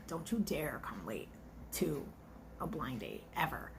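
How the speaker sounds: hushed warning. Real, not acted. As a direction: mutter it as a stern private warning. Stern aside scolding a late date.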